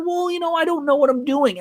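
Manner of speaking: high-pitched voicing of a worried newcomer; imitating worry. Nervous first-timer admitting she is lost, imitated worry. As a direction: play her worried, pitched well up. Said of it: "She was so worried".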